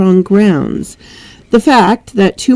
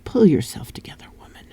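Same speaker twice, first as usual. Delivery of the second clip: whispered self-scolding, quick; quick whisper telling herself to focus; 9 dB quieter, faster than usual. Real, not acted. Reader scolds herself after stumbling, whispering fast. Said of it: "self talk after flub".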